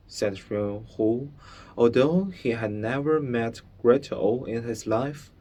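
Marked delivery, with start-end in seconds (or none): none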